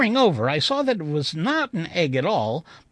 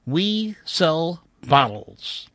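slow, deliberate reading of the sign; much slower than usual. Shown as a demonstration. Narrator spelling out a dusty shop sign, slowly. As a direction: read each word slowly. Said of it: "which read".

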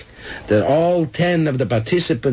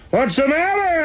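high, odd creature voice; squeaky alien oyster asking. A narrator's reading.

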